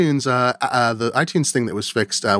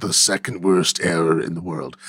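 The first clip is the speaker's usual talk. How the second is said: mock announcer; 6 semitones lower than usual. Mock dramatic correction.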